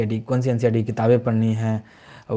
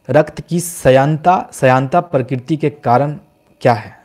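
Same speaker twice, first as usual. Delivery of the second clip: slow, deliberate, each word spaced out; रक्त की श्यानता read out slowly like dictation; slow quiz read; slower than usual. Shown as a demonstration. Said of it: "repeated right after at normal pace".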